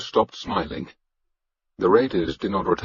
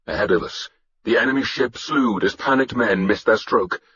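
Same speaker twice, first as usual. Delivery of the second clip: usual speaking voice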